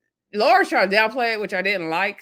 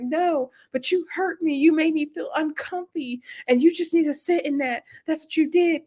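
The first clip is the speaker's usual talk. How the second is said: Chelsea's hurt rebuke; 7 semitones higher than usual. Retold or imitated. Retold hurt partner in quarrel.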